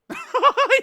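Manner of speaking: loud, high laugh; burst of laughter. Real, not acted. Streamer cracking up at a comment, loud laugh.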